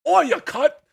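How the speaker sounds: quick hammy Cockney insult, loud and high; Cockney accent. Retold or imitated. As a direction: shout it like a Cockney Knuckles. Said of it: "Cockney British accent ... he just goes".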